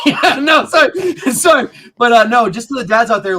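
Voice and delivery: loud, laughing apology. Real, not acted.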